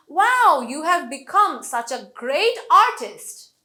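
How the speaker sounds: loud, slow praise with "become" as be-COME and "artist" as AR-tist; big Wow, punch "great" and "artist"; stressed key words. Shown as a demonstration. Teacher praising an imagined artist, stressing be-COME and AR-tist. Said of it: "become বললাম এবং artist এভাবে".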